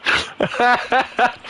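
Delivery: short high amused laugh. Real, not acted. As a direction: laugh at the absurd result. Laughs at surprising result.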